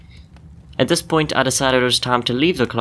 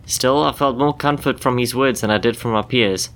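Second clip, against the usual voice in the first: ordinary delivery, nothing marked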